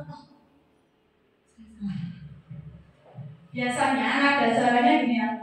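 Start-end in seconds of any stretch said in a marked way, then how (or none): 0.1-2.1 mild remorse. Shown as a demonstration. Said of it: "Anak langsung".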